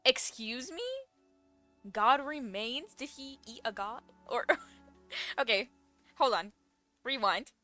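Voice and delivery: ordinary talk, nothing marked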